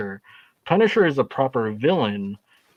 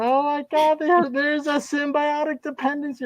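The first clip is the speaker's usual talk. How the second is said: much higher, shocked mock voice; mock dramatic gasp. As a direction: gasp it like a melodrama. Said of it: "I'm like".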